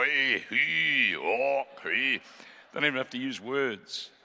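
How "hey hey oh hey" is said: drawn-out Scottish noises, rising and falling; mock a Scotsman talking in long wordless vowels. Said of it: "Scotsmen use their eyes".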